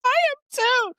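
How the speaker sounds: high, loud burst of joy; excited squeal. Real, not acted. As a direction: squeal it back at her. Host echoes guest's excitement.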